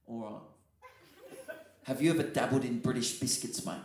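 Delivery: low, hushed hotel clerk's offer; quiet, secretive imitation of the clerk; confidential lean-in. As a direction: lean in and ask it discreetly. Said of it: "So he leans in, he goes".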